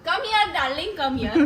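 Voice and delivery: loud mock-sweet beckoning; playful calling over. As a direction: call your darling over loudly. Calling the other over for camera.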